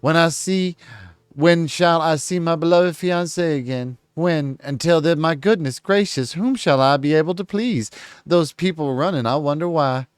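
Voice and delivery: high, theatrical Southern belle; hammed-up southern lady voice; southern accent. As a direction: lament like a vain Southern belle pining for her fiancé. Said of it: "I don't know why I'm giving her a southern accent".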